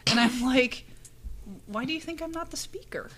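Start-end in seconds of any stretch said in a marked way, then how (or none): 1.7-3.1 high, whispered retort, softer; sarcastic whisper. Retold or imitated. Answering the attendee's dumb question in a whispered mock retort. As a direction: hiss the comeback under your breath.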